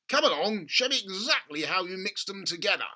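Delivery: loud, high eager dad; an impatient father, keen and loud; excited father. Acted. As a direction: push him to show you, eagerly. Father voiced in story.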